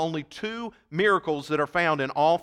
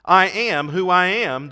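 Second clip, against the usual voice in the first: "I am who I am" slow and louder; emphatic declaration; 2 semitones higher, 7 dB louder, slower than usual. Acted. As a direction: proclaim it slowly with weight. Preacher voicing Jesus's declaration.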